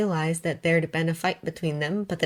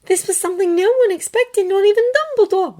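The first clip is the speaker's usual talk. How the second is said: put-on young woman explaining, a little higher; Hermione's voice; 7 dB louder than usual. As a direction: voice Hermione gently but urgently. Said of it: "Hermione said".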